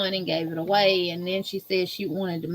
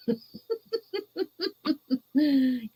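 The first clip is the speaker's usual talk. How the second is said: long, high, tapering laugh; real laugh; 6 semitones higher, 3 dB quieter than usual. Real, not acted. Host laughing at own diaper warning to viewers, long high laugh. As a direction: run of high giggles, falling away. Joking that viewers will wet themselves.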